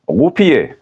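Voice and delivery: roupiller lifted high and stressed; emphasised word. Shown as a demonstration. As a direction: pop roupiller up high to show it. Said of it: "Je peux aussi dire".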